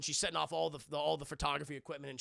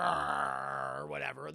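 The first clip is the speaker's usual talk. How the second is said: ordinary delivery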